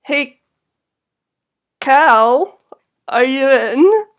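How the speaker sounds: loud call to a friend. Acted. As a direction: call through the door. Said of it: "calls out".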